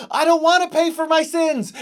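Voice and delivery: high, loud frightened plea; panicked cry. Acted. Ed waking from a nightmare, panicked loud cry. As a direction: blurt it out in terror.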